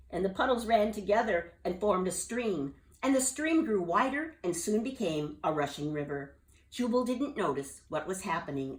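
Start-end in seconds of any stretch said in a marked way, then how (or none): none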